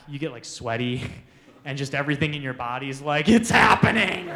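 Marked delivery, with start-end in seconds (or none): 3.3-4.3 loud, comic cry of alarm; mock alarm shout. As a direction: shout it like your body sounding the alarm. Body's alarm before throwing up, acted.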